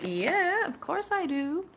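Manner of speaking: high, drawn-out mock-keen yes; eager retold reply. Accepting leftover pens from old job, eager retold reply. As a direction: act out jumping at an offer.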